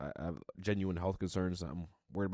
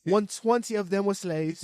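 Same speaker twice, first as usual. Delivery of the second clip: high, loud mock-British report; terrible British accent; 10 semitones higher, 7 dB louder than usual. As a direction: report it in bad, pitched-up British. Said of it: "terrible British accent".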